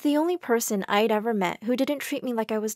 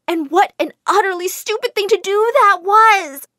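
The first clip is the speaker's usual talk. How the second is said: exasperated; 9 semitones higher, 8 dB louder than usual. A narrator's reading. Self-mocking narration.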